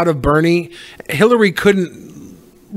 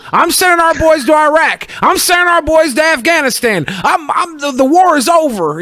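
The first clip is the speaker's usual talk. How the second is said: hammed-up president boasting; mock Bush announcing his wars; George W. Bush voice; 9 semitones higher than usual. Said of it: "he, he".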